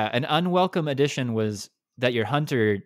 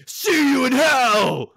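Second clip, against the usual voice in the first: mock battle roar, loud and drawn out; hammed-up edgy yell, pitched way up; gruff, edgy hunter; 13 semitones higher, 8 dB louder, much slower than usual. Imitating the hunter's Silkbind attack line, edgy shout. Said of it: "like, gruff ... trying to be, like, edgy".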